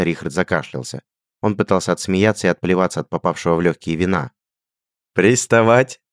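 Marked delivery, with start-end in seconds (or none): 5.1-6.0 higher, louder choked-laugh question; laughing disbelief. A narrator's reading. Richard chokes with laughter at his friend's news. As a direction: squeeze it out through laughter. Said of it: "выдавил охотник, утирая слёзы".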